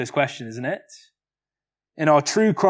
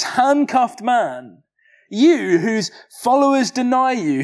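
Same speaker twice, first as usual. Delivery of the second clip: Pilate's mockery; 6 semitones higher than usual. Pilate to the handcuffed Jesus, mocking his kingship. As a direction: sneer down at the prisoner. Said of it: "In other words, he's saying".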